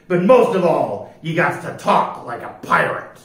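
loud, declaimed pirate voice; declaring pirate captain. A narrator's reading. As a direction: lay down the rules like a pirate captain. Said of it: "Pete nodded and declared".

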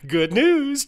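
loud, very high delighted exclamation; high, triumphant customer-service cheer; exclaiming. A narrator's reading. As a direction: announce it with big cheer. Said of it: "Jarvis exclaimed".

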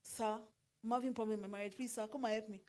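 a troubled wife messaging the pastor, softly pleading. Retold or imitated. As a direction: beg quietly for help. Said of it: "enter Pastor K's D M".

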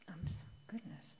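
a flustered whisper to herself; softly whispered self-correction; whispered aside. Real, not acted. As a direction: mutter the slip under her breath. Flustered aside after misreading.

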